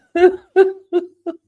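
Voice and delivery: high, loud burst of laughter; big laugh. Real, not acted. Host cracking up at viewer's pretzel comment, loud laughter. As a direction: let a real laugh burst out.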